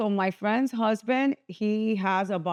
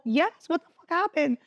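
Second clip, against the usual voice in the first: much higher, stunned demand; high disbelieving cry; incredulous outrage; 8 semitones higher than usual. Retold or imitated. Seeing her wrecked car, she demands answers, high and stunned. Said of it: "I go".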